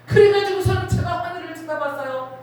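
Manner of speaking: ordinary talk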